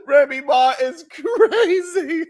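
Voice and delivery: laughing through the words, slow and high; real laughter, drawn out. Real, not acted. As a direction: crack up while saying it. Laughing disbelief.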